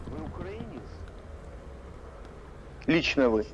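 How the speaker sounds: normal talking voice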